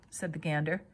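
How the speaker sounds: ordinary delivery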